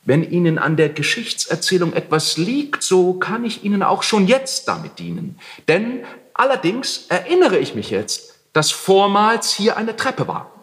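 calm him down lightly; placating. A narrator's reading. Heinrich soothes the angry landlord, placating.

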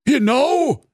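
high-pitched octopus exclamation; octopus calling out. Acted. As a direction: chime in loudly as an eager octopus. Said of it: "rief der Tintenfisch". (rufender Tintenfisch, hohe Stimme)

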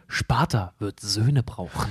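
low, slow and soft; grave warrior king speech; Spartan war voice. Retold or imitated. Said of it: "als wir in den Krieg ziehen".

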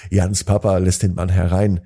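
ordinary delivery

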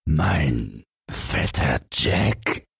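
very slow, baffled question rising at the end; baffled giant. Acted. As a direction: drag it out, the giant caught off guard. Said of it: "rief der Riese verdattert".

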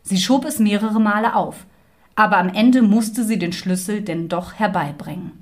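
usual speaking voice

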